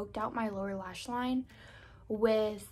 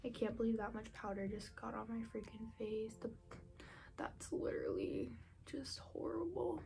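whispering; 11 dB quieter than usual. Real, not acted. Whispers while applying makeup.

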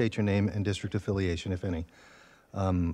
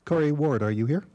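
raised voice calling a name; 5 semitones higher, 5 dB louder than usual. Real, not acted. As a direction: call out to find someone. Chair calls for online speaker.

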